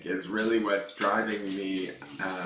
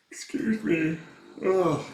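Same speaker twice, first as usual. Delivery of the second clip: yawning; 9 semitones higher, 4 dB louder, slower than usual. Real, not acted. Yawning mid-sentence on stream.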